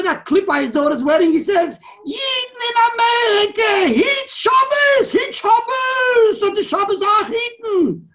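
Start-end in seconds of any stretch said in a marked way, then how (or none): 2.0-8.1 high, loud, tearful shout, drawn out slowly; imitating an old rebbe's weeping cry, much higher and louder; Minchas Elazar's crying plea. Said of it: "That's the way he said it".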